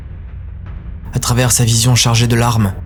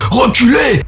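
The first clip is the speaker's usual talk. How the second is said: much higher, loud commanding yell; loud shout; 13 semitones higher, 5 dB louder, slower than usual. Acted. Arbitress yelling at the child, loud command. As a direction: roar the order to back off. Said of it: "Crie encore l'arbitresse".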